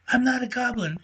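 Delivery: high squeaky little squirrel voice; small squeaky critter calling down from a tree. Acted. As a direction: pipe it up like a tiny squirrel. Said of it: "said a squeaky voice".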